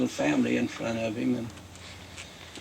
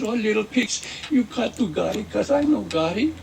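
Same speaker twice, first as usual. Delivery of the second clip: put-on loud, high wolf with a Mexican accent; upset big bad wolf; 7 semitones higher, 5 dB louder than usual. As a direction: protest loudly as the put-out wolf. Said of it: "makes the big bad wolf feel so very bad".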